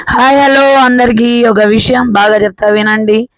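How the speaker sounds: loud, slow hello to the viewers; loud greeting. Real, not acted. Vlogger greeting her viewers, loud and slow. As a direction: call out to everyone, drawn out.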